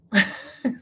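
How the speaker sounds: short, breathy chuckle; amused laugh. Real, not acted. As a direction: crack yourself up for a moment. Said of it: "I crack myself up".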